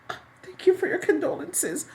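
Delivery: high, hushed and dainty, with a laugh; hammed-up teary thank-you; mock grieving widow. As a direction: mimic a prim widow dabbing her eyes. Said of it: "she dabbing at her eye like".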